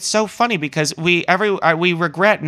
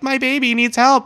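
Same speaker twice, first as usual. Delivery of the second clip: higher, pleading mock voice; mock beggar plea; 10 semitones higher than usual. Imitating Paris pickpockets begging, high pleading mock voice. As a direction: fake a pitiful plea for help. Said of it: "pickpocketers who are like".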